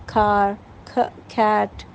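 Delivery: کھ sound set apart before کھار and کھیٹ; phonics demo. Shown as a demonstration. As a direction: sound out کھ, then the word. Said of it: "English میں ہے کھ".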